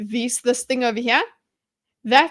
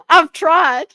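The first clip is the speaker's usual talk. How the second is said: laughing; 8 semitones higher, 8 dB louder than usual. Real, not acted. Laughing about her accent.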